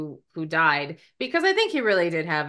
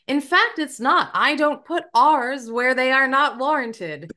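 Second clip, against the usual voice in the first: higher-pitched, mock-prim indignation; indignant retort; 6 semitones higher than usual. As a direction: correct them with exaggerated offence. Retort to a remark on accent.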